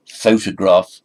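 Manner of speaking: photograph slow, stressed loudly on PHO; PHO-tograph, first syllable strong. Shown as a demonstration. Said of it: "stress on the first syllable".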